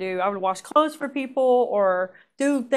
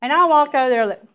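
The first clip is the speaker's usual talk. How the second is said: normal talking voice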